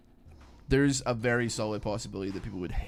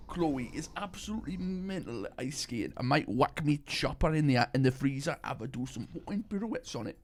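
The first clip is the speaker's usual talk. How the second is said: put-on Geordie, pitched up, breaking into a laugh; Geordie Shore accent; 4 semitones higher than usual. Redoing the Geordie Shore line, mock Geordie accent. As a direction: repeat the quote in mock Geordie, amused. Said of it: "what is it from Geordie Shore".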